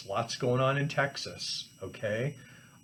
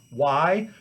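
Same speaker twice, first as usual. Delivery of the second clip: usual speaking voice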